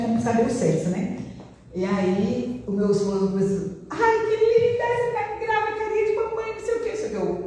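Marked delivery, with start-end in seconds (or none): 3.9-6.9 high, gushing sing-song praise; doting husband imitated. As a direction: mimic the husband cooing over his wife. Marido encantado imitado. (marido imitado, voz aguda e derretida)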